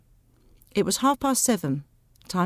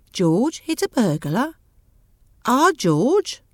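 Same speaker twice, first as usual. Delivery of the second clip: slow, incredulous questions with rising ends; astonished disbelief, swooping up at the end; surprised; 4 semitones higher, 3 dB louder, much slower than usual. Acted. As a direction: say it as if you can't believe it. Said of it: "It was obvious that he was surprised".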